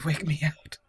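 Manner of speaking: normal talking voice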